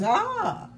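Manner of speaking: high, swooping "ah" of discovery; knowing "ah". Retold or imitated. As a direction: let the "ah" leap up high. Imitates his knowing reaction.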